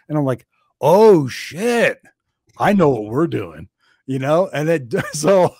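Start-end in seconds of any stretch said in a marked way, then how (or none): 0.8-3.7 hammed-up eureka moment; excited realization. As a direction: blurt it out like you just got an idea.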